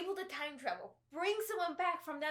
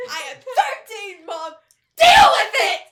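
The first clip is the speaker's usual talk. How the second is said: put-on bratty teen yell; 8 semitones higher, 20 dB louder than usual. Rebellious teen yelling at mom.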